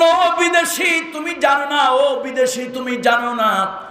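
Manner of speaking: high, ringing declamatory cry. Acted. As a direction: call it out high, stretching the words. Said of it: "একটা চিৎকার দিয়ে উঠল".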